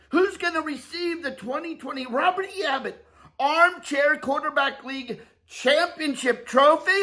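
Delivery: rising hype announcer build-up. Pretend. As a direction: build the crowd up before the call.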